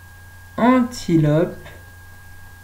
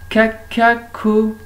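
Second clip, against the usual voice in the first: slow, louder and higher; slow word; 4 semitones higher, 4 dB louder, slower than usual. Shown as a demonstration. Teacher to pupils, slow and higher. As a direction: start the word slowly for the class. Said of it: "cacahuète".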